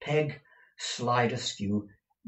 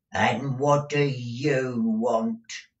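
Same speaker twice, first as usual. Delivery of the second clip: put-on slow, drawn-out, raised old-crone voice; deaf old woman; 3 semitones higher, 6 dB louder, much slower than usual. A narrator's reading. Deaf housekeeper answering her master, slow old-woman drone. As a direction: draw out each word like one hard of hearing.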